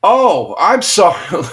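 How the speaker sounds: loud, high, laughing apology. Real, not acted. Streamer noticing a viewer he ignored, loud laughing apology. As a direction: burst out sorry with a laugh.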